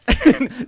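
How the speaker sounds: sudden high burst of laughter; loud laugh. Real, not acted. Storyteller laughing at his own story, loud high laugh. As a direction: crack up loudly at the memory.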